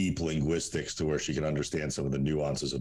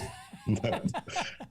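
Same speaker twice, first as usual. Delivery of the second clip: amused laugh; 3 dB quieter than usual. Real, not acted. Laughing at parents' pharmacy meeting.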